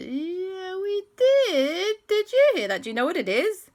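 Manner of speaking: squeaky, excited and loud; high excited voices. Acted. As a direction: kids thrilled by a mystery. Excited kids answer frog.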